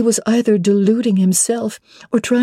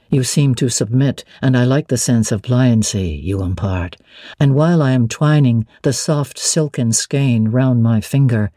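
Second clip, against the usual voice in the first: lower, steady male voice for Mr Rochester; 9 semitones lower than usual. Acted. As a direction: drop into the master's lower register, assured. Rochester voiced by female narrator.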